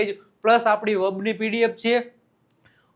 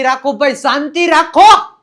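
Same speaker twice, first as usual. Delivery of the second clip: acted shout for silence; 4 semitones higher, 10 dB louder than usual. Acts out demanding silence. (શાંતિ માટે મોટેથી બૂમ)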